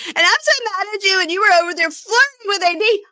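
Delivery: high, loud, exaggerated jealous whine; mocking the fiancée's past jealous outburst, high and loud; mocking whiny voice. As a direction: parody her jealous complaint. Mocking her past jealous complaints.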